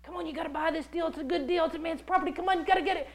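rapid-fire high-pressure sales pitch; hurried pushy salesman. Acted. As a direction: rush them into buying without a breath. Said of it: "he wants to hurry and talk you into it".

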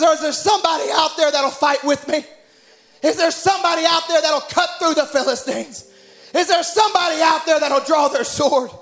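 high, preached appeal, building in force; preacher's retold rallying plea; impassioned pleading. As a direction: plead with the crowd, each question stronger. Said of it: "And he just pleads".

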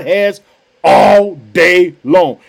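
very slow, stretched-out words; drawn-out emphasis. Real, not acted. Preacher praising God's unending mercy, drawn-out emphasis. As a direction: stretch each word out for emphasis.

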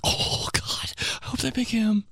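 hushed hopeful whisper. Retold or imitated. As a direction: whisper it to your friends, barely voiced. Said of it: "And you're like".

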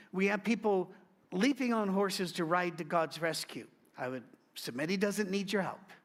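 normal talking voice

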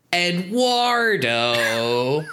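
drawn-out mock call of a name. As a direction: stretch the name slowly, imitating the characters. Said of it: "show up and go".

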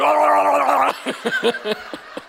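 long, high garbled roar; mock monster roar; death metal roar. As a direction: bellow wordlessly like a metal singer. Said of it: "this death metal band is, like, coming at her".

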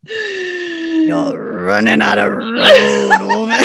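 second try at a hammed-up growl, breaking into laughter; loud, slow, drawn-out growl collapsing into giggles. Podcaster retries Doc's line to Wynonna, laughing growl. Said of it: "Let me try again".

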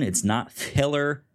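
ordinary talk, nothing marked

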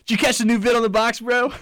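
high, loud surfer-bro voice; dude bro voice. Pretend. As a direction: play a trendy early-2000s dude. Mocking early-2000s dude slang.

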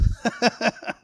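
a quick amused laugh breaks out; short high laugh. Real, not acted. Preacher laughing at Saul's confusion, short laugh. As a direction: laugh lightly at the surprise.

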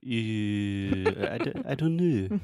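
put-on light French-accented "Uh, I d-, I don't know." with a pitch rise; a hint of a hesitant French speaker, rising at the end; French hesitation. Teacher imitating a French speaker unsure, lightly French-accented. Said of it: "a French person saying like".

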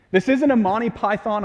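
ordinary talk, nothing marked

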